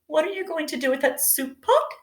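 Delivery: squeaky high bunny voice asking who; little rabbit, confused; high-pitched bunny voice; high puzzled Buddy. Acted.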